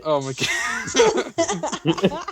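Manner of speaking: high, slow, laughing oh my God; laughing in disbelief at her own writing; laughing exclamation. Real, not acted. Reading her old story aloud, she cracks up laughing. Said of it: "laughing at own story".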